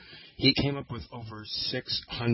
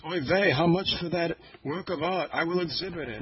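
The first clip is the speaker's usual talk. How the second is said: high, put-on Yiddish accent; 7 semitones higher than usual. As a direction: voice a Yiddish-accented art dealer. Retold quote of a passerby.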